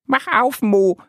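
high, bright little duck Annie. Acted. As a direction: play the small duck, pitched well up. Ente weckt den schlafenden Bären. (Annie weckt Mo mit hoher Entenstimme)

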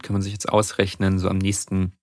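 ordinary talk, nothing marked

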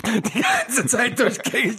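announcer voice. Pretend. Continuing announcer bit.

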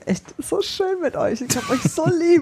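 put-on slow, high, gushing little-kid voice; like a sweet little child saying I love you. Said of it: "die Hörer denken jetzt, das Kind sei wieder reingekommen".

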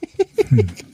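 real laugh you try to swallow; stifled giggles. Real, not acted. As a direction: giggle held back behind the lips. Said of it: "Und hör auf zu lachen".

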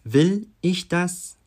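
"Will" loud, high and drawn out; high slow question led by a hammered "Will"; stress on Will. Shown as a demonstration. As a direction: punch a rising "Will", then slow "ich das". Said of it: "mit drei unterschiedlichen Betonungen".

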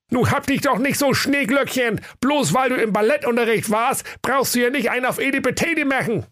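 very high, cheeky flower voice; a sassy little flower mocking a snowdrop; crocus voice. Crocus teases the snowdrop in a very high voice. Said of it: "lief blau an und sprach".

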